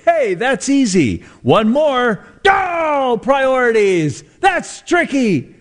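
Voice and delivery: high, drawn-out mock astonishment; hammed-up wailing over a tricky puzzle; mock dismay. Host mocks a misspelled school poster, hammed-up dismay.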